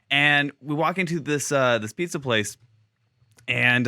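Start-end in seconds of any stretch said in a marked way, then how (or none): none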